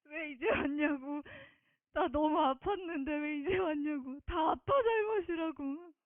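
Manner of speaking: 울음이 섞인 채 떨리는 목소리로 회상; 다시 북받쳐 울먹이며 말함. Real, not acted. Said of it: "다섯 살 짜리처럼 엉엉 울었어요". (울먹이며 간증하는 목소리)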